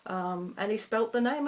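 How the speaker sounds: ordinary delivery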